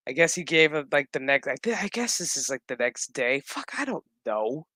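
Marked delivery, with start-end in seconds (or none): none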